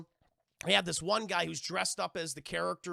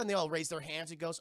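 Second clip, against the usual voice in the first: ordinary talk, nothing marked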